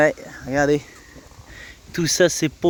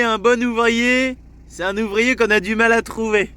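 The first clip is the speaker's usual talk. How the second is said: imitating Jérém; 7 semitones higher than usual. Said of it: "Jérém, il disait".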